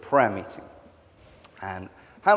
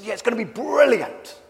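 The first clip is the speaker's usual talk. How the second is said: higher, louder mock-enthusiastic friend; imitating keen friend; 11 semitones higher, 6 dB louder than usual. Vicar friend promising the prayer meeting will be brilliant, mock-excited. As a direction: play the eager vicar selling the night.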